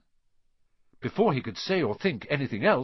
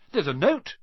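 higher and louder sudden blurt; 8 semitones higher, 5 dB louder than usual. A narrator's reading. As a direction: blurt it out all at once. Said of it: "said Ron suddenly".

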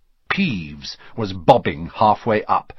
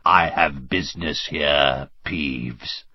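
low, slow, hoarse fake ghost; croaking; 9 semitones lower, 3 dB quieter, slower than usual. A narrator's reading. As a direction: croak it with cold authority. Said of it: "croaked Harry".

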